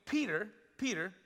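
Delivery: ordinary talk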